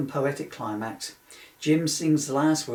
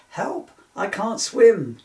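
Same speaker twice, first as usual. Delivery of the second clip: high, slow, loud mock panic; a drowning woman yelling for help; hammed-up panicked shout from the lake; cry for help; 9 semitones higher, 8 dB louder, slower than usual. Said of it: "cries Nunu".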